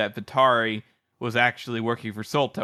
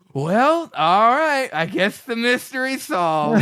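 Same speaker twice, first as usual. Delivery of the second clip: loud, high, exaggerated sarcastic concession; mock sarcastic acceptance; 10 semitones higher, 6 dB louder, slower than usual. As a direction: play up being done with this nonsense. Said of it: "I do love the tone in his voice".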